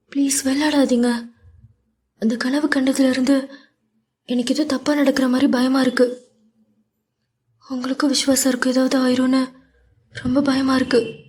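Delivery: a mild fearful plea; understated fearful Meera; frightened. A narrator's reading. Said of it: "Meera பயப்பட".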